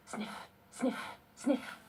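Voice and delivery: whispered sniffing sounds, rising; dog sniffing. Acted. Hunting dog sniffing through the grass for the duckling, whispered. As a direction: sniff it out like a searching hound.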